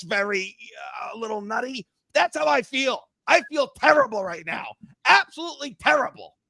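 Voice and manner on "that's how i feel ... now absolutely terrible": high-pitched angry fan rant, really worked up; screaming mad. Real, not acted. Yankees fan venting to his friends about losing Juan Soto, screaming mad. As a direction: vent at the top of your range, truly aggravated. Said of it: "I'm screaming into my mic".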